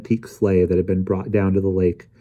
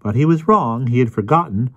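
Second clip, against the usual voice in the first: higher-pitched, self-assured lecturer; Emerson's know-it-all voice; 7 semitones higher than usual. Acted. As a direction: explain it all with smug certainty. Voices Emerson explaining smugly.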